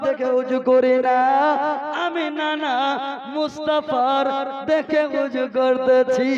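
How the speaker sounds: loud, chanting sermon delivery; preaching at full force. Acted. Preacher telling the crowd about Hasan and Husain, full-force preaching. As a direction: preach it to the whole gathering.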